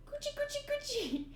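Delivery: very high, soft coochie coochie; tickling baby talk. Acted. Tickling the clown, very high squeaky voice.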